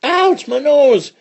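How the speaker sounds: high, loud, drawn-out yelp of pain; crying out. Acted. Outlaw bumped about in the stagecoach, high pained cry. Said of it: "cried Buzzard Bates".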